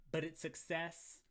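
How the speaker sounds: ordinary talk, nothing marked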